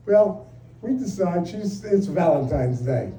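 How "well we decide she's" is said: ordinary talk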